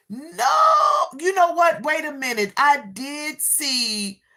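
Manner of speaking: high, loud burst of surprise; sudden realization. Real, not acted. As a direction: it just hit you, blurt it. Sudden recollection mid-stream.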